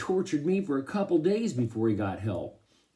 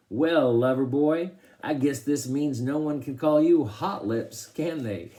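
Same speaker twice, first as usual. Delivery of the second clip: louder, higher mock-smooth ribbing; teasing; 4 semitones higher, 6 dB louder than usual. A narrator's reading. Dad ribs his stuck son, loud teasing. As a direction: rib him in a raised voice.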